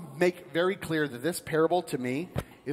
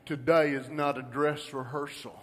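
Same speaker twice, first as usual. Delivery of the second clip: put-on thick Georgia accent; 5 semitones lower than usual. Preacher quoting a seminary president, thick Georgia accent. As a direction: quote it in a broad Georgia accent. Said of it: "I'll put on my thick Georgia accent".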